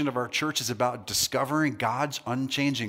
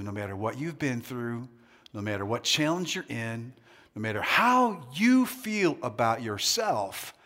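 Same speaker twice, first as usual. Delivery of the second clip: ordinary talk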